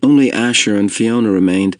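ordinary delivery, nothing marked

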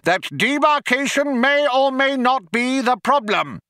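high, strident insistence; yelling. A narrator's reading. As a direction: yell it as an official demand. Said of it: "yelled Vroomfondle".

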